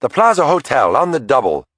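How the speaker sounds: woman yelling the destination to the cabbie; yelled out. A narrator's reading. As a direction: call it out eagerly to the cabbie. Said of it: "Mary Catherine yelled out".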